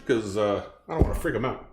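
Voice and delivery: ordinary delivery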